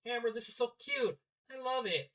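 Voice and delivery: high-pitched gushing praise. Acted. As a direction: gush with delight, pitch lifted. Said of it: "Pearl gushed".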